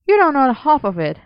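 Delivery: high, whiny grumble; grumbling. A narrator's reading. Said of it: "Merlin grumbled".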